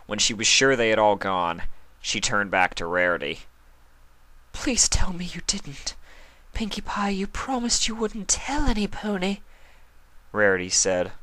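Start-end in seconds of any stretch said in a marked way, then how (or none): 4.5-9.4 hushed, high whispered dread; whispered plea. Acted. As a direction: fear of a secret out. Rarity alone with Pinkie.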